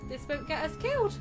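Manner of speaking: ordinary talk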